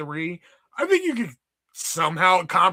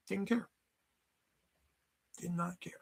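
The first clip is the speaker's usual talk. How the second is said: whispered; 15 dB quieter than usual. Real, not acted. Repeating his indifference.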